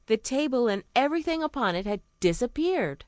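plain reading voice

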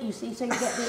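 ordinary talk, nothing marked

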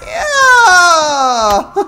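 high, loud cheering yell; excited shouting. Real, not acted. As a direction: cheer at the top of your voice. Cheering wildly at game.

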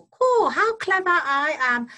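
high, loud crowing jackdaw voice; boastful jackdaw. A narrator's reading. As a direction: crow over your own cleverness. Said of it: "said Jackdaw".

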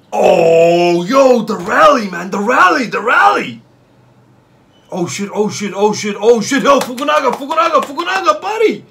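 loud, rising high-pitched cheer; excited shouting. Real, not acted. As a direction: scream for the player. Cheering long rally.

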